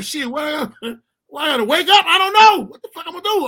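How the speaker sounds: put-on protesting kid. His own protest to mama.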